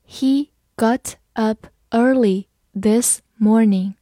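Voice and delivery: slow, word by word; slow read-along. Shown as a demonstration. Teacher leading learners through the sentence, slow and measured. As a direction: separate each word for learners. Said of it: "好，慢慢来读".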